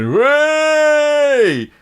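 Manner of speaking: loud, high, long-held mock cheer; cheering lads. Game master voices lads sat around the dartboard, cheering. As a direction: whoop it like a rowdy pub crowd. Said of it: "all the lads that are sat around going".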